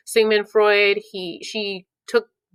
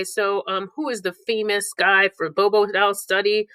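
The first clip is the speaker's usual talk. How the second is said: usual speaking voice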